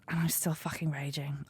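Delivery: hushed, breathy fury under the breath; a low whispered vent of rage. Real, not acted. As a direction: whisper it, still seething. Venting quietly about her anger.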